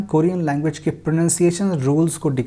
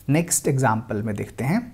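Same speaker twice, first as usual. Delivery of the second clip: normal talking voice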